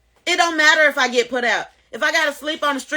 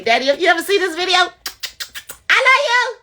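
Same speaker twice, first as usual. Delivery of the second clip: calling out to daddy; 8 semitones higher, faster than usual. Real, not acted. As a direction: rise to a high, affectionate holler. Calling out to her father on video.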